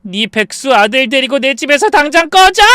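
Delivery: high, loud angry outburst; explosive shout. Acted. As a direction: let pent-up fury burst out in a yell. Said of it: "전 그렇게 소리치며".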